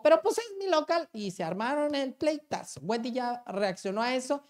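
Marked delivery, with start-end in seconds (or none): 0.0-1.1 higher, louder indignant snap; hammed-up outraged owner; mock angry retort. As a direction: throw it back like it's obvious. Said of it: "Y dijo".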